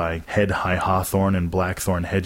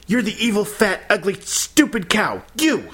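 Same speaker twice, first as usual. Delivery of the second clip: very high-pitched screeching tirade; furious shouting; 13 semitones higher than usual. A narrator's reading. Girl shouting abuse at her teacher, furious. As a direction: build the insults to a final outburst. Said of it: "she shouted at Missus Wilson".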